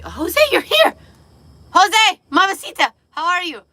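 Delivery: high, loud delighted welcome; excited greeting. Real, not acted. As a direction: squeal hello to a friend who just joined. Greeting friend joining call.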